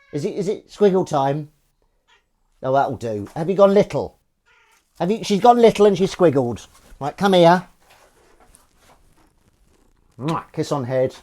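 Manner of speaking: put-on high, loud baby-talk quiz voice; cat voice. Calling his kitten over for tuna in a high, loud cat voice. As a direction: coax the cat with a big high voice. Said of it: "That's the cat voice for you".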